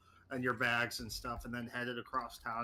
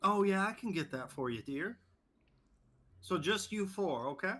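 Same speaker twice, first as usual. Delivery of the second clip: lightly voiced hostess, pitched up; a hint of an obliging hostess, lilting upward; friendly restaurant hostess; 5 semitones higher than usual. Hostess seating a party of four, lightly voiced and obliging. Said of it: "I go up to the host".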